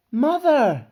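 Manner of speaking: high, loud cry across a crowd; calling out. A narrator's reading. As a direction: call out for your mother. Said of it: "a voice called out from the crowd".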